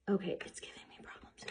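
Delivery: frustrated whisper, fast. Real, not acted. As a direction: mutter it under your breath in frustration. Frustrated aside under breath.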